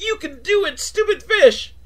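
high-pitched mock cheer; Christopher Lloyd cheer. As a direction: squeal it like an excited cheerleader. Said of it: "trying to cheer him on".

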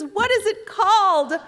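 high, loud, slow imitated mother; drawn-out, hammed-up motherly question; eager mother. Mother asks daughter the book's title, eagerly.

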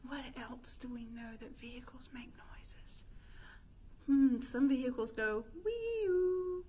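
normal talking voice

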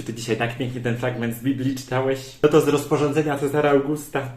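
ordinary talk, nothing marked

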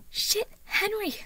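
high, loud exasperated curse; angry growl. A narrator's reading. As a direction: curse your brother in drawn-out irritation. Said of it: "Harriet growled".

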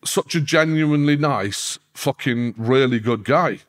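slow, emphatic sincere praise; mean every word slowly. Real, not acted. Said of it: "I'm not just saying that".

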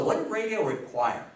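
ordinary talk

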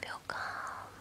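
ordinary talk, nothing marked

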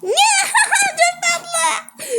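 high loud laughing cry of delight; laughing shriek of delight; delighted squeal. Real, not acted. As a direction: squeal with joy at something cute. Delighted by a cute outfit.

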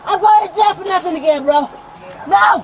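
ordinary delivery

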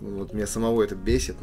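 ordinary talk, nothing marked

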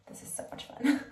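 breathy excited whisper to the camera. Real, not acted. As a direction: whisper it like a secret thrill. Giggly aside to camera.

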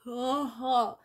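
ordinary delivery

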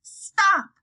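put-on girl ordering the carriage to halt; firm command. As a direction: bark it at the driver. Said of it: "I told him".

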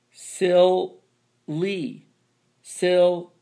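"Silly" split into sil, pause, lee; teacher sounding out "silly" with a long ee; sounded out by syllable. Shown as a demonstration. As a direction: draw out "Silly" in two high, separate beats. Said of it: "Not silly".

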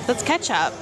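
ordinary delivery

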